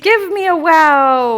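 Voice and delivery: loud, slow and high hype call; hype shout. Pretend. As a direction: call it out like a cheerleader. Hosts hyping chat for wow.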